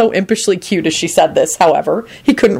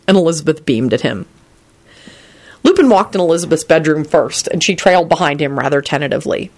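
usual speaking voice

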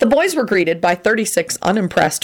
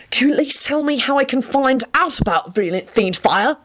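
higher-pitched earnest plea; pleading; 8 semitones higher than usual. A narrator's reading. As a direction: beg to be told, voice raised in pitch. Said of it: "Lupin said pleadingly".

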